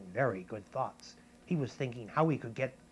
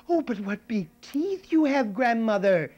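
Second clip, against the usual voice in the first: put-on much higher, louder frightened girl; a child growing alarmed; 9 semitones higher, 9 dB louder than usual. Girl seeing the teeth, high and alarmed. Girl's third question.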